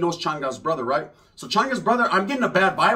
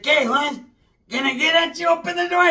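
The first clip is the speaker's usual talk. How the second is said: calling through the door; 10 semitones higher than usual. Retold or imitated. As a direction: yell to be let in, pitched up.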